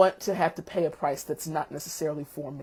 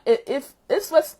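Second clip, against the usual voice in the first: normal talking voice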